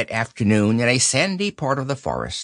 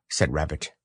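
ordinary delivery, nothing marked

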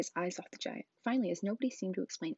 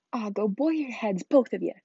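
dismissive Hagrid; 3 semitones higher, 9 dB louder than usual. Acted. Giant silences uncle and aunt, loud dismissal. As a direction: brush them off loudly.

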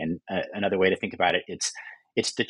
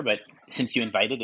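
normal talking voice

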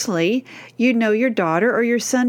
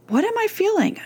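much higher, wondering self-question; inner voice; 11 semitones higher than usual. Shown as a demonstration. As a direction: ask yourself like a puzzled child.

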